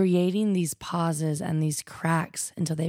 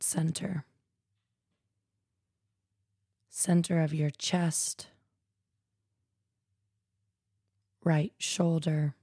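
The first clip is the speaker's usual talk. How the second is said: calm, even meditation voice with long pauses; guided meditation. Real, not acted. Yoga teacher guiding listeners through nidra, calm guided meditation. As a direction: guide them gently into stillness, leaving silence between phrases.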